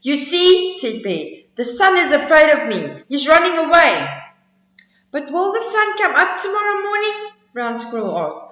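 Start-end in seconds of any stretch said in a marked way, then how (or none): 0.0-0.8 loud, proud bear voice; boastful bear. Acted. Bear boasting to the squirrel, loud proud voice. 1.6-4.1 loud, proud bear voice; boastful bear. Acted. Bear boasting to the squirrel, loud proud voice. 5.1-7.2 high squeaky squirrel voice; little squirrel. Acted. Squirrel questioning the bragging bear, high squeaky voice.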